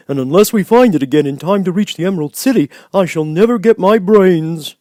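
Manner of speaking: high, loud complaining scarecrow; grumbling scarecrow. A narrator's reading. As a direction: whine it like the Scarecrow.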